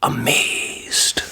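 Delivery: soft hushed whisper; whispered awe; whispered amazed. A narrator's reading. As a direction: breathe it in wonder. Whispered word.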